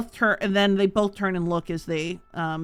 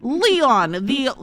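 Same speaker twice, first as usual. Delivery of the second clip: a proud warrior woman sneering, somewhat louder and higher; mocking Brunhilde; 6 semitones higher, 5 dB louder than usual. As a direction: she introduces heroes to shame him. DM plays Brunhilde mocking the townmaster.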